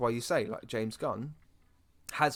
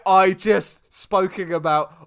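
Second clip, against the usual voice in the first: mock dramatic outburst; 8 semitones higher, 14 dB louder than usual. Joking about own hypocrisy.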